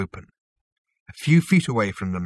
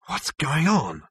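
higher-pitched, tense demand; spitting anger; 7 semitones higher, slower than usual. A narrator's reading. Zaphod demands answers from Zarniwoop, contained spitting anger. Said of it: "spat Zaphod".

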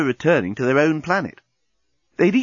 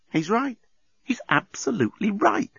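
Lucy's private thought; 10 semitones higher than usual. A narrator's reading. Lucy conceding to herself, high-voiced thought. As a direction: think it aloud in a high voice. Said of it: "Lucy suddenly thought".

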